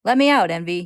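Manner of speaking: loud, urgent call. A narrator's reading. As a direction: call out to be released. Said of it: "he calls".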